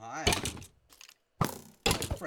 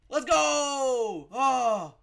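loud, high, drawn-out cheer; triumphant shout; 8 semitones higher, 16 dB louder, much slower than usual. Real, not acted. Player finally winning over his pet, shouted in triumph. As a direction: whoop with relief. Celebrates success in game.

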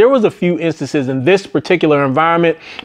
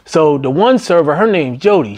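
ordinary delivery

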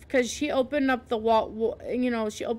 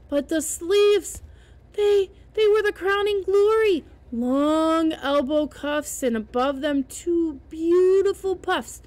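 higher, louder, slow, savoring each word; rising excitement; 5 semitones higher, 5 dB louder than usual. A narrator's reading. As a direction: build to the crowning glory. Narration swells describing sleeves.

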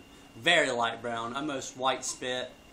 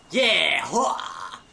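loud hyped yell; big excited yeah; excited shout; 3 semitones higher, 7 dB louder than usual. Real, not acted. Hyped intro for dip review.

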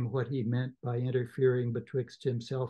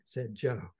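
ordinary talk

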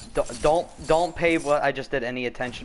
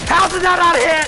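high, loud outcry; high, loud yell over a missed shot; disbelieving yell; 13 semitones higher, 7 dB louder than usual. Real, not acted. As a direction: shout in disbelief at the miss.

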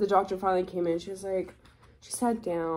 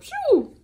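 1 panicked exhale; 5 dB louder than usual. Retold or imitated. Panic about telling parents.

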